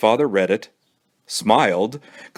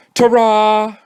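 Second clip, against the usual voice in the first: triumphant ta-ra; 12 semitones higher, 5 dB louder than usual. Acted. Billy showing off the last bite.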